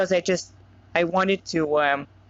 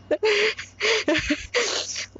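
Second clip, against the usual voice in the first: a high, airy burst of laughter; high delighted giggle; 13 semitones higher than usual. Real, not acted. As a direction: giggle at your own idea. Laughs at own fan wish.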